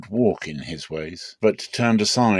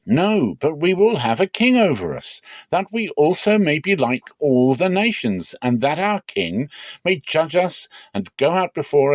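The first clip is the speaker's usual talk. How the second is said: higher and louder, the people insisting; insistent crowd demand; 7 semitones higher, 3 dB louder than usual. Acted. The people refusing Samuel, insisting loudly on a king. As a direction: push back hard, demanding a king.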